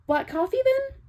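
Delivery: syrupy sarcastic question; fake-bright offer. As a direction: sweeten it till it's sarcastic.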